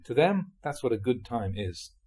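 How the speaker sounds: usual speaking voice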